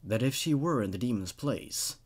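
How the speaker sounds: steady, measured reading of a dark tale; storyteller reading a horror tale aloud; horror story narration. A narrator's reading.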